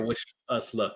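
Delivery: a soft, hollow repeat of the phrase "Wish us luck"; faint mimic echo. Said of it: "right after it said".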